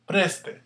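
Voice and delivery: "Preste" with a high, punched "Pres"; stress on first syllable. Shown as a demonstration. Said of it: "Where's the stress".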